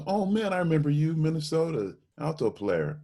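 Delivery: high, bright mock bandleader greeting; delighted recognition. Bandleader recognizing the young alto player, high and delighted. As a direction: greet him like an old friend.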